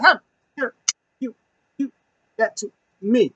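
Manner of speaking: high, halting disbelief; imitated indignant sputter, broken by pauses; mock outrage. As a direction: act out outrage one word at a time. Acts out outraged slapped man.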